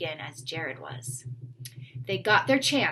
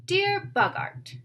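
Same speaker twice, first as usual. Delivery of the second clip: high, drawn-out formal opening; mock-grand letter opening; slow, raised dictation; 11 semitones higher, slower than usual. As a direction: savour each word as you dictate.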